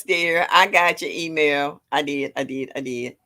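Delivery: ordinary talk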